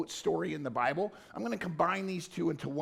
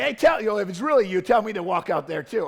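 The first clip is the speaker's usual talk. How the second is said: loud, eager request; eager plea, called out loud; 3 semitones higher, 10 dB louder than usual. Retold or imitated. Peter eagerly asks Jesus to let him walk out, loud.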